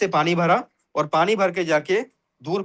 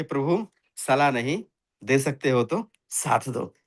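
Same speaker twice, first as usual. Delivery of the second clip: soft, slow, lower folded-hands request; gentle pleading to the god, slowed down; humble plea; 5 semitones lower, 3 dB quieter, slower than usual. A narrator's reading. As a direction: calmly beg the lord with folded hands. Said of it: "आराम से हाथ जोड़े और बोले".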